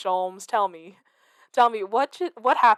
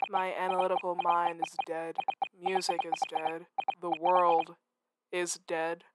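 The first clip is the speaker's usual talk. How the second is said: softer, lightly voiced despairing gentleman; morose detective; 3 semitones lower, 8 dB quieter than usual. Sholmes mourning to Iris, softly despairing. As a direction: mope each line like a melodramatic genius.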